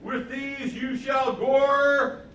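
high, loud, very drawn-out proclamation; enacted prophecy. False prophet acting out prophecy with horns, mock-grand proclamation. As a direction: chant it grandly at one pitch. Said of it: "he has sort of an enacted prophecy".

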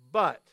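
but punched out loud; stress on but. Shown as a demonstration. Preacher stressing scripture's turning word, loud emphasis. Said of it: "isn't that a great word?".

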